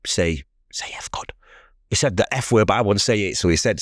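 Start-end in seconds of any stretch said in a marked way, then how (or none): 0.1-1.3 slow whisper, imitating a voice heard; whispered inner voice. Voice tempting a grieving man to swear at God, whispered. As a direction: whisper it like a voice in the head. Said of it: "I just heard a voice say".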